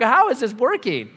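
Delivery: incredulous aside, voice up; astonished. Retold or imitated. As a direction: act out baffled amazement. Astonished that neighbor agrees.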